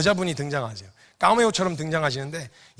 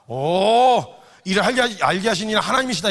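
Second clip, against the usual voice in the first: mock grand praise; 3 semitones higher, 5 dB louder than usual. Jesus praising Peter grandly.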